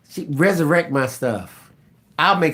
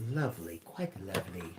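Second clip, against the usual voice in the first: lower, soft posh British accent, lightly put on; mock British accent; 7 semitones lower, 17 dB quieter than usual. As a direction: read the viewer's line as a prim English lady. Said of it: "she can really talk sound English".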